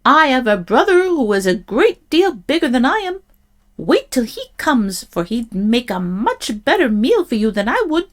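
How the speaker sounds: high little goat voice; pleading little goat. A narrator's reading. Little goat talking the troll out of eating him, high plea. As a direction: plead your case in a small high voice. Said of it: "he cried".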